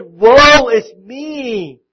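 slow, loud, stretched-out cry of woe; Isaiah's lament. Retold or imitated. Isaiah cries out before God's glory, slow and loud. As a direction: drag each word out in loud despair. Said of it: "he fell down and he said".

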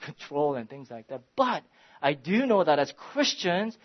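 preaching at full force. Acted. Pastor urging his small church, full-force preaching. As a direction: preach it out over the congregation, rising. Preaching climax on Christians' duty.

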